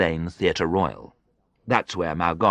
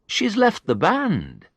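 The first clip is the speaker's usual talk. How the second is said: higher, slow, surprised; 13 semitones higher, slower than usual. A narrator's reading. Conductor tells Derek his wife quit, surprised. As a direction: let the surprise lift the line. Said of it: "sounding surprised".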